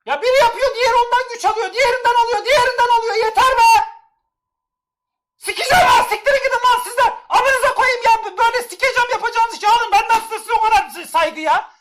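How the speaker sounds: high, loud ranting with bursts of laughter; angry rant. Real, not acted. Streamer yelling at spamming chat, angry but laughing.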